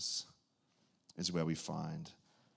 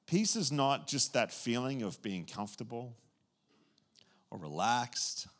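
usual speaking voice

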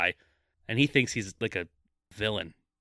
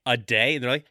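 ordinary talk

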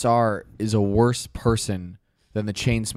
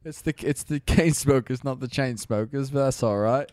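mock British correction; British accent. Band member correcting the host on their name, mock British accent. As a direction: set them straight in a put-on British accent. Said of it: "it's a British thing".

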